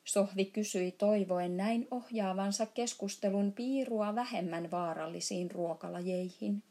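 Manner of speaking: plain reading voice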